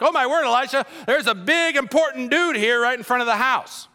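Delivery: high, excited imitated announcement; mock amazed servant. Servant tells Elisha about the important visitor outside, mock amazement. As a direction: report it like an awestruck servant.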